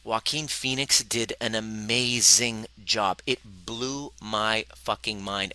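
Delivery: usual speaking voice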